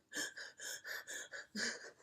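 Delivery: mock sobbing gasps in quick bursts; rapid breathy heaving, played for laughs; imitated hyperventilating. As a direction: act out hyperventilating from crying. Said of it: "like, hyperventilating. I was going, like".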